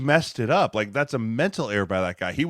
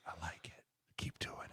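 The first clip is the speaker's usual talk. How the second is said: whispered approval; secret whisper; 5 semitones lower, 22 dB quieter than usual. Retold or imitated. Coach whispering encouragement to his scout-team player. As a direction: hush it like a sly coach's aside.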